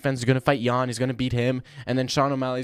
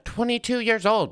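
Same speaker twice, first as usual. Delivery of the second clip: mock indignation; 10 semitones higher, 3 dB louder than usual. Indignant about his age.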